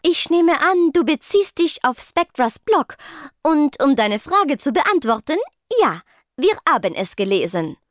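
composed Rochelle figure. A narrator's reading.

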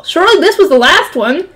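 ordinary delivery

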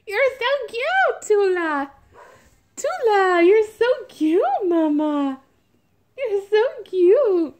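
adoring high-pitched squeal. Real, not acted. Gushing baby talk to doll.

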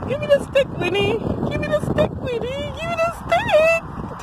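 very high teasing demand; high-pitched doggy talk; playful pet coaxing. Real, not acted. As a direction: coax a dog in a squeaky voice, drawing out the last. Coaxing dog to drop stick.

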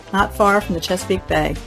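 normal talking voice

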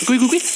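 high little cuy chirps; imitated chirps. Streamer mimicking the game, high chirps. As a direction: chirp like a tiny critter.

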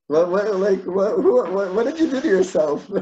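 high, loud, stammered disbelief; imitating someone taken aback; retold incredulous challenge. Retold rabbi challenging the man.

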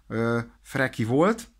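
ordinary talk

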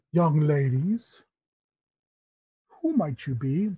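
slow, savoring theatrical host; effusive Tabaxi uncle. Acted. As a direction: dwell fondly on each phrase, with long pauses. Tabaxi host greets his children.

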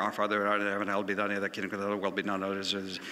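mock auctioneer, speeding up to a gabble; auctioneer prayer. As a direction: rattle the prayer off fast like an auctioneer. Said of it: "he sounds like an auctioneer".

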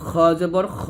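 both খ thick and throaty; heavy KH. Shown as a demonstration. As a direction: round and thicken each খ. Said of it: "মোটা করতে হবে". (মোটা করে গলা থেকে খ)

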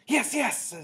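high, loud insistence; shouted. A narrator's reading. First witch answering the doubters, shouted. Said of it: "shouted the first witch".

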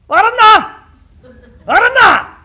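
loud high call across the room. Acted. Kuma calls out to the sick young master, loud high call. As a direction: bellow his name to rouse him.

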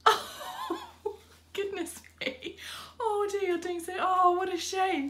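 laughing dismay. Real, not acted. Nuts in chocolate again, dismay.

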